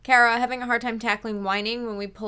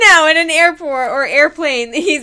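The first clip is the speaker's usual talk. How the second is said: loud laughing complaint; 3 semitones higher, 11 dB louder than usual. Real, not acted. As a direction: laugh through the telling. Laughing while telling story.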